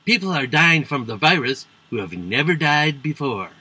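put-on presidential impression; Trump. Comedian quotes the president's remark on the virus, in a mocking impression. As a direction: mimic the president in a mock voice. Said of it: "Trump said".

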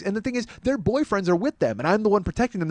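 ordinary talk

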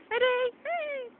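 high, loud squeal as she throws; excited throw. Real, not acted. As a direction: rev the dog up for the snowball. Throwing a snowball.

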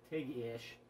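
ordinary talk, nothing marked

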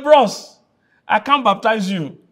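high, loud comic disbelief; astonished acted protest. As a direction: protest loudly as a flabbergasted John. Acts John protesting to Jesus.

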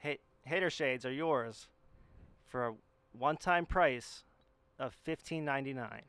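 infomercial voice. Pretend. Hater Shades mock infomercial.

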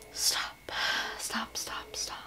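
very soft, voiceless disbelief; stunned whisper. Real, not acted. Book fan discovering cards in the parcel, stunned whisper. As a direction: whisper it, too thrilled for full voice.

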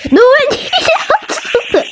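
high laughing whine to leave; laughing plea. Real, not acted. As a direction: beg through laughter. Laughing, pleading intruder away.

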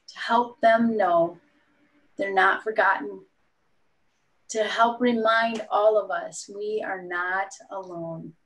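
usual speaking voice

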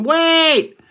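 high, loud panicked "Wait!"; panicked yell. Retold or imitated. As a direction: yell it as a fleeing pizza guy. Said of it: "He's going".

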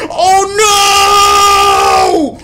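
high, loud wail of dismay; real horrified shout. Real, not acted. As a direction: cry out in panic as it all goes wrong. Sudden disaster in the game.

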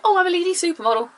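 high, quick put-on voice; mock supermodel. Models suddenly turning up to introduce themselves, mock voice. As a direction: toss it off high and fast, mocking.

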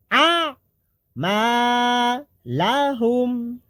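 আ sung high from a pressed throat, then মা লাহুম chanted slowly; pressed-throat ain. Shown as a demonstration. Teacher showing how the ain is pressed from the throat. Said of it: "আইন উচ্চারণ গলা চেপে হবে।".

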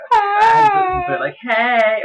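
put-on girly voice. Shown as a demonstration. Showing how she typed hey as a girl, high girly voice. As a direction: pitch way up like a girl saying hey. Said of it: "I'm gonna do this in a girl's voice".

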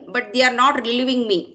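usual speaking voice

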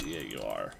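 mock the enemy coolly; sarcastic dismissal. Real, not acted. Gamer taunting an opponent, low sarcastic sneer.

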